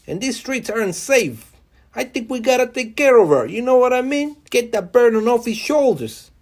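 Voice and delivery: high, loud hammed-up New York mobster voice; New York mobster accent. Vlogger mocking Trump as a New York mobster, hammed-up New York mobster accent. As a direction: play a bad New York mobster boss giving orders. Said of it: "Sorry for my terrible mobster accent".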